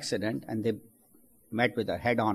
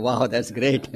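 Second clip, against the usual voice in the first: laughing wow. Acted. Everyone thinks, while laughing.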